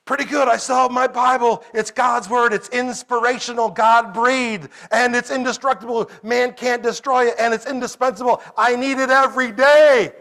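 put-on a boy telling a staff member about his Bible; proud little boy. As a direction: recite it proudly like a child who learned his lesson. Boy answers staff in Walmart.

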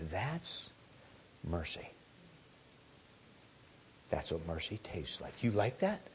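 put-on father leaning over the child, soft and gentle; tender dad. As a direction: lean in and say it softly.

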